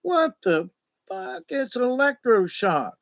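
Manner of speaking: very high, startled what the fuck; recoiling in disbelief; a retold shriek of alarm, pitch flying up; shocked outburst. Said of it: "I'm going".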